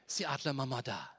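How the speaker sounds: ordinary delivery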